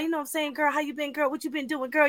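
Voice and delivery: softly imitated swinging street talk. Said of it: "like, little swang, swang with his talk".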